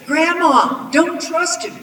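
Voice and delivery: put-on high, loud child's cry of warning; alarmed girl. A narrator's reading. Granddaughter warning Grandma about the man, urgent cry.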